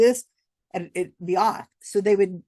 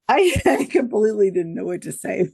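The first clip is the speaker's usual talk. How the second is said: loud, laughing confession; helpless laughter breaking into the words; 4 dB louder than usual. Real, not acted. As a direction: talk through giggles. Laughing at own memory.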